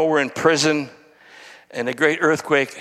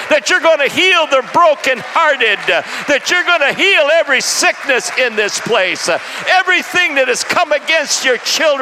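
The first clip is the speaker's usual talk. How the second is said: impassioned, raised-pitch prayer building in intensity; fervent preached prayer; 6 semitones higher, 4 dB louder than usual. Real, not acted. Preacher calling on God to heal the congregation, fervent prayer. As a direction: lead them in full-throated prayer.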